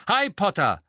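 high, loud, furious call; angry shout. A narrator's reading. Angelina calls after Harry, loud and angry. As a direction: bark his name across the hall. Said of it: "rief eine laute und zornige Stimme".

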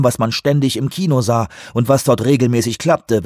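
ordinary delivery, nothing marked

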